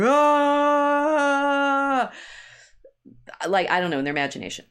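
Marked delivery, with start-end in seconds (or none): 0.0-2.1 long high wordless yell; mock mob scream. Colleagues' imagined hordes running through the streets, mock screaming. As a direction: draw out a loud, high "aah" like a running horde. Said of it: "run screaming through the streets going".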